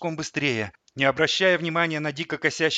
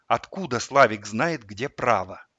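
ordinary delivery

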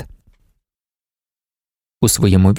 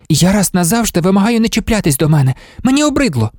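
high-pitched, exasperated demand; angry; 7 semitones higher than usual. A narrator's reading. Marta ordering her colleague to leave her alone, angry. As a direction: raise the pitch in indignant protest. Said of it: "розлютившись".

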